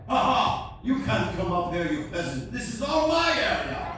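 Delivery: high, loud mock gloating; hammed-up lording it over the passengers; arrogant captain. Captain keeping peasants off his bridge, arrogant gloating. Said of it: "Don't you think that's a little arrogant?".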